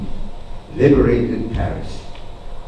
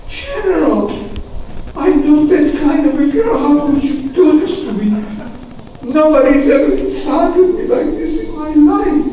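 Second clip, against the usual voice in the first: high, loud, scandalized waitress; mock outrage; 12 semitones higher, 4 dB louder than usual. Offended waitress scolding General Patton, high mock outrage. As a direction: protest in a shocked, high-pitched voice.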